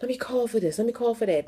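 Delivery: imitating staff who checked in on her; mock caring staff. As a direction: imitate them fussing to call. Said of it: "to be like".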